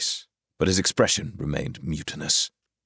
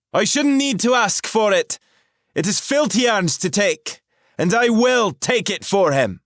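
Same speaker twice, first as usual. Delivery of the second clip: high, loud commanding woman's voice; a sorceress demanding answers, loud and clear; 6 dB louder than usual. A narrator's reading. Rahal challenges the intruders, high and commanding.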